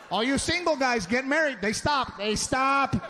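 ordinary talk, nothing marked

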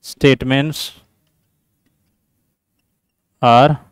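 word-by-word reading, pitched low; slow dictation. Real, not acted. Teacher giving students the answer options, slow dictation. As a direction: read each option out slowly, pausing between words.

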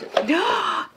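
high, delighted gasp; excited gasp. Real, not acted. Showing viewers the planter example, excited gasp. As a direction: gasp at how cute it is.

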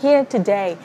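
ordinary talk

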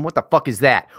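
ordinary delivery

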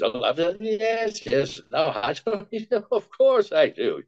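high, flustered absent-minded professor; Frank Morgan. Shown as a demonstration. Voice actor demonstrating his little professor, high and flustered. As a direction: dither in a high wizard voice, chuckling. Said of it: "I wanna do him as Frank Morgan".

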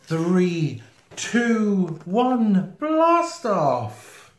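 rising to a loud blast off; building countdown. Acted. Everyone counting down to launch, building excitement.

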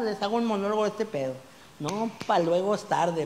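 ordinary talk, nothing marked

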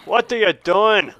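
slow, drawn-out, emphatic question; exasperated scolding. Real, not acted. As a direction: scold with stretched-out words. Repeats question more forcefully.

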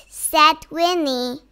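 ordinary delivery

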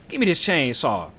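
usual speaking voice

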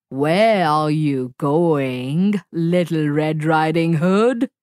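low, slow, menacing wolf growl; wolf's growl. Acted. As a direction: growl it out slowly like a hungry wolf. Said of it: "growled the big bad wolf".

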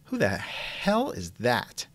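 ordinary delivery

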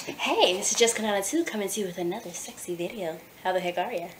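ordinary talk

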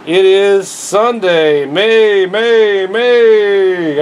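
slow halting read, struggling to see; squinting slow read. Real, not acted. Vlogger reading the date without glasses, slow and halting. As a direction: drag the words out, straining to read. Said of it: "I can't even read it".

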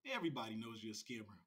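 low muttered repeat. Real, not acted. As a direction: say it under your breath, falling away. Same accusation repeated under his breath.

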